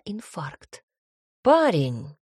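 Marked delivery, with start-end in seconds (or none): 1.4-2.2 sharp angry exclamation. Acted. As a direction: snap the word out angrily. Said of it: "Отец зло сузил глаза".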